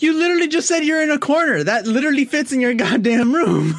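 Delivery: high, loud, fast, incredulous; laughing disbelief; exasperated. Real, not acted. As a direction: fire it back in disbelief.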